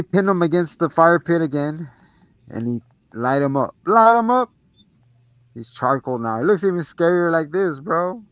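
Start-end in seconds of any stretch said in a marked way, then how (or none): 3.9-4.5 excited shout. Retold or imitated. Cheering the burning.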